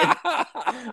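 high giggling laugh; cracking up at the story; burst of laughter. Real, not acted. Laughing at cop mistake.

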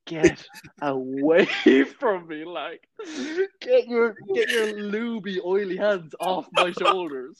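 slow, high, laughing get-away; half-laugh him off in mock horror; mock-disgusted, laughing through it. Retold recoil from massage seller.

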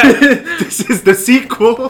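mock nurse. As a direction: drawl it out as a wry nurse. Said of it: "She said".

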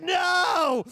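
long, high, loud wail of refusal; hammed-up drawn-out protest; mock anguished cry. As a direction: cry out in comic dismay. Dismay that skinny jeans are dead.

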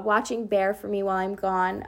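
usual speaking voice

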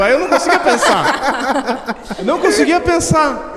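high, slow, laughing cry of nervous panic; scared and laughing, telling friends he froze up; laughing in fear. Real, not acted. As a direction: half-shout the words through laughter. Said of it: "eu tenho medo".

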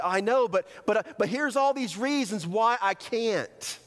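stumbling, high-pitched protest; protesting excuse. Acted. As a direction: argue back with a pleading push. Acting out excuses to God.

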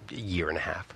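unvoiced under-the-breath admission; sheepish whisper. Real, not acted. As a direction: confess it quietly, embarrassed.

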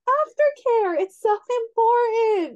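high, loud and eager; gushing with enthusiasm; excited. Retold or imitated. Retelling her own excited reaction.

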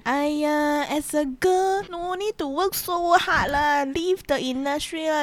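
high, fussy elder nagging; nagging auntie. Retold or imitated. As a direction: scold like a worried auntie. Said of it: "They will be like".

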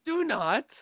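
high, firm warning; a daughter warning her dad, pitched high; mock stern warning. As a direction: warn him high and sharp.

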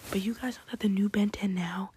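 ordinary talk

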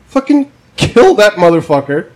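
normal talking voice

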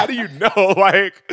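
retelling his own question high, laughing. Retold or imitated. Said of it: "I'm like".

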